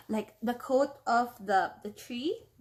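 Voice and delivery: ordinary delivery, nothing marked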